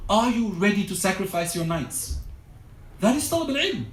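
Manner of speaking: normal talking voice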